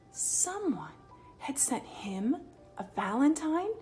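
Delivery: ordinary delivery, nothing marked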